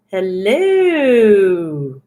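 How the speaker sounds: long, falling hooted greeting; Missus Doubtfire. Acted. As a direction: drag the word out in a theatrical swoop. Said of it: "Missus Doubtfire".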